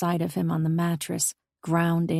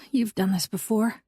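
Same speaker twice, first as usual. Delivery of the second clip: mumbled into a pillow; muffled mumble; 4 semitones higher than usual. A narrator's reading. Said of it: "I mumbled into the pillow".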